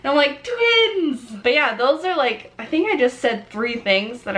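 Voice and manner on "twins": high, playful squeal of recognition; mock-thrilled, pitched way up; excited exclamation. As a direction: cry it out high and delighted. Acted reaction to actor's same trait.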